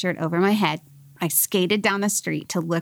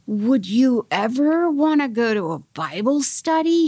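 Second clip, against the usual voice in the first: higher and drawn out, tentative; slow, scared asking; 5 semitones higher, slower than usual. Retold or imitated. Boyfriend nervously asking girlfriend at a party, slow and scared. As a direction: imitate a nervous, hesitant invitation, word by slow word. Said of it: "He, like, said it all slow".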